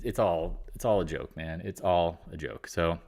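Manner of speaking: usual speaking voice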